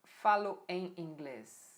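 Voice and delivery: "Falo em inglês" devagar, palavra por palavra; frase de exemplo destacada. Shown as a demonstration. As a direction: mostra o exemplo pausado. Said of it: "Você não fala". (exemplo dito com calma)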